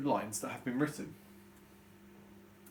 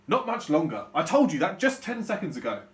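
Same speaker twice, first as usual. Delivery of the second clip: louder, exasperated father; angry dad; 3 semitones higher, 8 dB louder than usual. Shown as a demonstration. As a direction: bite it out at the kids. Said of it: "change my voice".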